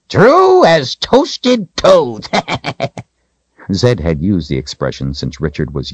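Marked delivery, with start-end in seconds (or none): 0.1-2.3 put-on high, loud, drawn-out old wizard; playful old codger, pitched way up; joking old man. A narrator's reading. Old Zed jokes with Richard. 2.4-3.0 put-on high old wizard's chuckle; high-pitched, gleeful old codger laugh; old man's cackle. A narrator's reading. Zed laughs at own joke.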